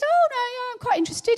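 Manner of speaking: high, bright-eyed stranger voice; eager young man. Retold or imitated. Young man stopped on the street tells Rachel he's interested, high eager voice. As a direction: voice the keen passer-by lightly.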